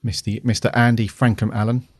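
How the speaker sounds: ordinary delivery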